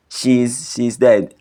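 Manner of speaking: ordinary delivery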